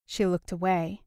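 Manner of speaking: ordinary delivery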